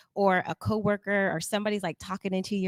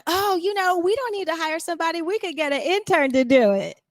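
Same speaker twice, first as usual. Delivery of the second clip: lightly mocking, pitch raised; mock dismissive boss; 8 semitones higher, 3 dB louder than usual. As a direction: toss it off like a careless boss. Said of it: "And the best one".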